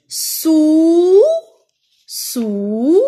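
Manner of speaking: each word stretched and rising; drawn-out word modelling. Shown as a demonstration. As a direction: hold the vowel long for learners. Teacher sounds out word slowly. (mot très étiré, pédagogique)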